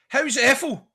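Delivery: high, loud blurted question; blurted greeting. Retold or imitated. Nervous fan blurting a question at the manager, high and loud. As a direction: blurt it out, caught off guard.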